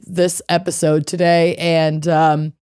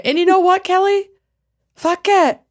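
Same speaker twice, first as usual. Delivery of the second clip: much higher silly squeal; high mock voice; 13 semitones higher than usual. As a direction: pitch it way up, playful. Cheering along with Kelly.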